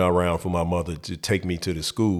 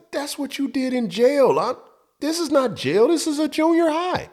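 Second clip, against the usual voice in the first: put-on shocked kid. Boy protesting penitentiary advice, very high shocked voice. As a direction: squeak out your outrage at the advice. Young self objecting again.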